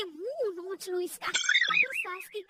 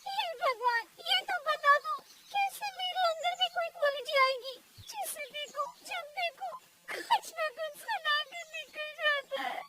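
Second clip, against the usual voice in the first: high-pitched wail to God, hammed-up; tearful plaint; 5 semitones higher than usual. Daughter-in-law complains to God on the roof, tearful. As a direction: plead with heaven, about to cry. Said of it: "वो रोने लगती है".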